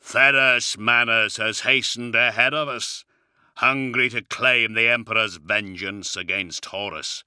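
booming, heavy warrior voice. Acted. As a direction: speak like a towering giant. Primarch voice continues.

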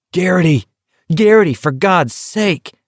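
high, pleading yell; urgent yell. A narrator's reading. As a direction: yell to get through to him. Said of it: "McVrees was yelling".

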